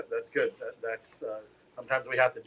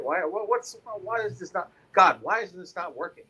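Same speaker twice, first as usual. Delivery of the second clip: higher-pitched exasperated questioning; baffled complaint aimed at heaven; exasperated pleading; 7 semitones higher, 4 dB louder than usual. Retold or imitated. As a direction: plead upward in mock frustration, louder on the last question. Acting out frustrated prayer to God.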